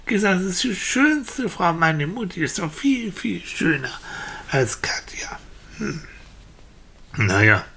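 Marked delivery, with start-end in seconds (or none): 0.0-5.4 put-on higher-pitched small boy's protest; insistent little boy. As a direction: protest like an indignant little child. (kleiner Junge, der beharrlich widerspricht)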